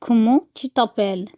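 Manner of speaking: very slow, every syllable clear; slow reading aloud. Shown as a demonstration. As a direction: read the question slowly for learners. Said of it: "فكاتب لي أول سؤال". (قراءة بطيئة واضحة)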